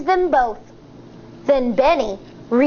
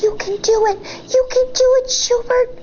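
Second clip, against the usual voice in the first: cheering; 9 semitones higher, faster than usual. Acted. Crowd urging Schubert to be brave, rising cheer. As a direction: build from hope to a cheer.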